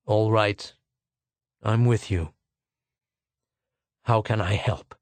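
giving in with a weight on every word; heavily. A narrator's reading. Schwartz gives in to Arviden, heavily and low. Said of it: "He said heavily".

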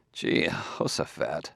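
soft, slow, half-voiced oath; muttered exclamation. A narrator's reading. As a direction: mutter it under your breath, drawn out. Bailey's silent exclamation under scrutiny.

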